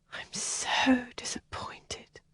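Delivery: slow, soft, sad whisper. Acted. Helen whispers her sorrow to Kathleen. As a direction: breathe out the disappointment. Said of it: "she whispered".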